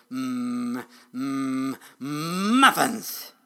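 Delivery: loud, drawn-out greedy humming; craving dragon. A narrator's reading. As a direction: hum hungrily, then burst out on the last word. Hungry dragon craving muffins aloud.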